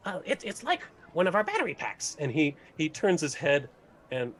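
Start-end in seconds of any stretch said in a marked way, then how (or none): 0.1-2.2 lightly raised friendly gnome; a touch of gnome. Acted. Gnome explains device to party, slightly raised and bright. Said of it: "Norman looks to you and he says".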